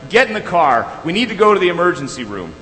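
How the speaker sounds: urgent mock parental order; acted-out angry dad, loud and high; retold angry father. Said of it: "A flood of anger filled me".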